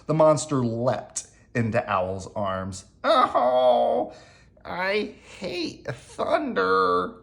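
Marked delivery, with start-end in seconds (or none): 3.0-7.1 high, slow little whimper. A narrator's reading. Monster leaps into Owl's arms at thunder, whimpering. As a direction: draw it out in a small frightened voice. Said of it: "he whimpered".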